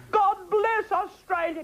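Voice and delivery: high slow blessing breaking into a laugh. Acted. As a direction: repeat the blessing while laughing.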